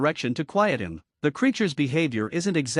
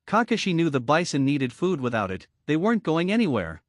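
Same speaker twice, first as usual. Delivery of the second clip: ordinary delivery, nothing marked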